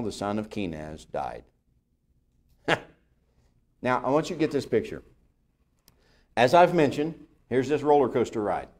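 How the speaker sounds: ordinary talk, nothing marked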